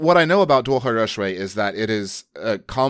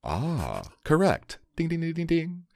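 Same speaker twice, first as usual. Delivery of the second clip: cheerful quiz host; 8 semitones higher than usual. Acted. Quiz host rewards answer.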